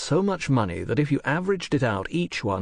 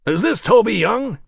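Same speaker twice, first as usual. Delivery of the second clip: American producer voice; 11 semitones higher, 7 dB louder than usual. Acted. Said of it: "work a lot harder on that American accent".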